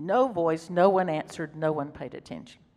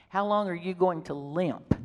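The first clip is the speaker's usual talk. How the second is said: ordinary talk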